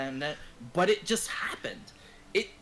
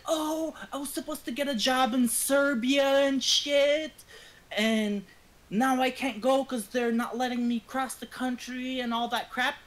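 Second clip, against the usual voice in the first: higher-pitched mock of her excuse; mocking quote; 10 semitones higher than usual. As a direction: retell her excuse in a raised, doubting voice.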